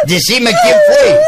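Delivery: angry yell. Acted. Father yelling at his daughter for the truth. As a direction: blow up at her.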